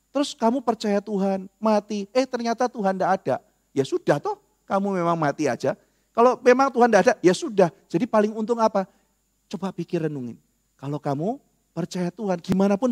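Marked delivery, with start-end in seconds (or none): none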